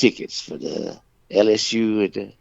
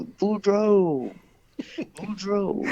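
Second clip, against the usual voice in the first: mock holler; 12 semitones higher, much slower than usual. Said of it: "just holler".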